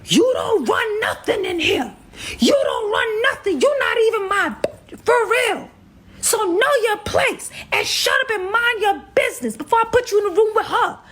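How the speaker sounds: put-on man yelling at his girlfriend; angry shout. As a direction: put her in her place hard. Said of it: "her guy go and say".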